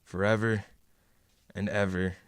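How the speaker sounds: low, hushed, honeyed reassurance; calm her down, and keep an edge of control; soothing. Acted. Harry turns soothing yet controlling.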